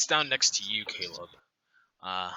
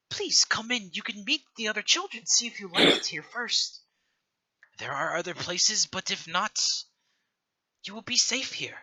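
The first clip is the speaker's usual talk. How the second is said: put-on lightly raised, gentle old woman's voice; kindly old matron; 9 semitones higher than usual. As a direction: welcome the children like a caring old keeper.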